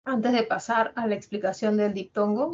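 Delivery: ordinary delivery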